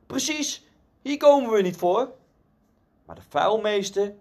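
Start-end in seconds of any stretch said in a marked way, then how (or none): 0.1-2.1 high-pitched agreeing call, only mildly raised; narrated call-out. A narrator's reading. Another bidder in the middle backing the heckler, high mild call-out. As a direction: call out high in agreement, without a full shout. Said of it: "Iemand anders in het midden riep".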